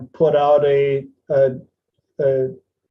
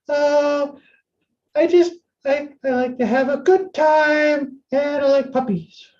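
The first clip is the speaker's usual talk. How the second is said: much higher, airy mock airhead; ditzy airhead voice; 12 semitones higher than usual. Mocking a bland dating ad in a ditzy airhead voice. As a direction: send up a bland dating profile.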